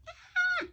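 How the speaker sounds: very high, short mock-panic squeal; tiny high shriek of alarm; panicked yelp. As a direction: yelp as if it landed wrong. Reaction when placement went wrong.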